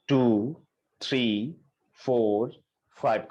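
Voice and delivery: slow, drawn-out counting with long gaps; breath-pacing count. Real, not acted. Yoga teacher pacing students through alternate-nostril breathing, slow measured count. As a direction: count out each breath slowly and evenly.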